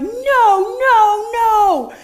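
loud high repeated no's; angry no. Retold or imitated. Mother stopping daughter at the gate, loud angry no's. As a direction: stop her dead with each no. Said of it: "explosion of anger".

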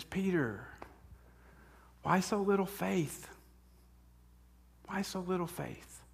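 soft, tender question; gently ask, fading to a murmur; gentle rebuke. Pretend. Jesus gently rebukes Peter.

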